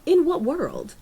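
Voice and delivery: high, loud disbelief at the plot; an incredulous outburst. Retold or imitated. Podcaster mocking the film's choices, incredulous. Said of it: "was just like".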